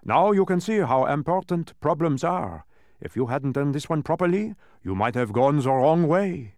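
high, loud lecturing voice; animated, pleased instructor in character; emphatic dodecahedron. A narrator's reading. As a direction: drive the lesson home.

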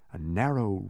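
plain reading voice

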